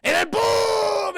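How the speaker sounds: explosion boom. Retold or imitated. Retelling explosion, shouted slow.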